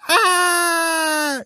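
high, loud, sustained scream of terror; panicked scream. A narrator's reading. Luca screams on the runaway Vespa, panicked. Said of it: "schrie Luca panisch".

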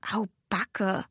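softly murmured dismay; murmuring. A narrator's reading. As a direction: murmur it half to yourself. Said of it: "murmelte Nelly". (leise vor sich hin murmeln)

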